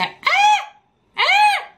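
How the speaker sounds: put-on piercing very high baby screech, loud; imitating baby screech. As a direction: mimic a baby shrieking. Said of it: "and he'll just go".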